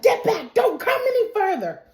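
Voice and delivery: high-pitched frantic command; acted warning. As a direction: warn off an attacker in mock alarm. Acting out knife threat at monster.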